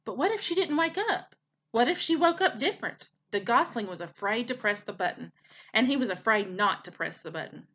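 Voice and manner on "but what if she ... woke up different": higher, rising fearful questions; worried narration of doubts; anxious worry. A narrator's reading. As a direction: let the fear climb. Gosling's fears in narration.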